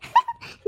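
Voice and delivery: high, loud gleeful cackle; gloating cackle. Acted. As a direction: cackle like a delighted schemer. Mother-in-law gloats. (ಖುಷಿಯ ಕೀಚು ನಗು)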